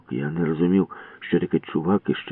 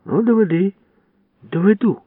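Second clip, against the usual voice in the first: defiant challenge; 14 semitones higher, 5 dB louder than usual. Acted. Challenge exchange.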